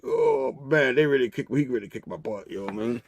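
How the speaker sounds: low, soft pained mumble; mock-defeated, sinking in pitch and volume; beaten-up groaning. Norman beaten on the ground, pained and quiet. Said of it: "Norman's all beat up in the ground".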